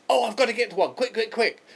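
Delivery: high, hurried plea for a piano; quick, high, pressing request; urgent Paul. Retold or imitated. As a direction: beg in a rush, a notch up in pitch.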